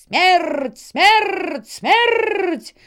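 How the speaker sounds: high, loud chant with rolled R's; thundering crow horde. A narrator's reading. Crow army echoes the death threat, high loud chant. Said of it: "грянуло воронье войско".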